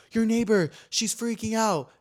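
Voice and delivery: high voice, drawn out at the end; high, dramatic caller voice; alarmed mock urgency. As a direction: break the news in imitated alarm. Acting out manager's alarmed news.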